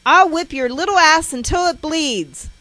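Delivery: high, very loud threat; enraged shouted threat; furious yell. A narrator's reading. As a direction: yell it as a raging mother. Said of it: "She is yelling".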